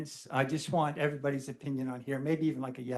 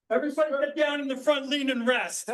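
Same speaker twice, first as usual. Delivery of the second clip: barked drill sergeant command, higher and faster; military voice; 11 semitones higher, 5 dB louder than usual. Pretend. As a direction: shout it like a drill instructor at recruits. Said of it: "should I do my military voice".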